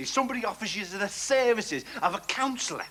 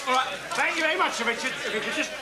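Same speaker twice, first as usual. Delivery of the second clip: pushy photographer; 6 semitones higher than usual. Acted. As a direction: rattle off thanks like a paparazzo snapping away.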